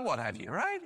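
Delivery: normal talking voice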